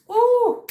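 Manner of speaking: high, loud "ooh"; one long, high squeal of delight; excited whoop. Real, not acted. Exclaims over the gummies.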